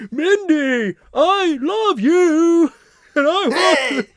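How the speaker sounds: high, slow alien voice; squeaky alien crush voice; Mark the alien voice. Retold or imitated. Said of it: "like, a Homer voice?".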